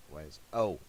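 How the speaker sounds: usual speaking voice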